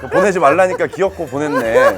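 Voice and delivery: amused exasperation, chuckling; laughing fondly at a friend's blunder. Real, not acted. Laughing about Sehun's message.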